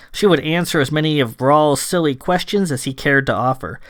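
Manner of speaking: plain reading voice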